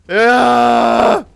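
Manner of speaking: long, high, loud yell; battle cry. Real, not acted. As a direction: yell as you charge in for revenge. Attacking for revenge.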